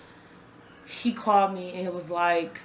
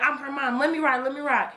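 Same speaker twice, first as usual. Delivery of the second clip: higher-pitched insistent begging, retold; urgent plea; 6 semitones higher than usual. Mother begging to ride in the ambulance, urgent plea. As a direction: replay pleading to be let aboard.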